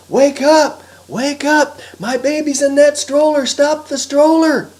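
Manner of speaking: high, loud urgent yell. Acted. Harry wakes the sleeping raccoon, yelling. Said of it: "Harry began to yell".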